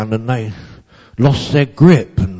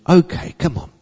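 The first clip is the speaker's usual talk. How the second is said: light, higher friendly nudge; kind coaxing; 8 semitones higher than usual. A narrator's reading. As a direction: cheerfully coax the child along. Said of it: "she was a kind voice".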